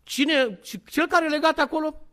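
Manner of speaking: high, loud, protesting mock denial; frantic denial. Peter swearing to the servant woman, frantic acted denial. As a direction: play a man swearing innocence, hammed-up. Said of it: "S-a jurat de trei ori".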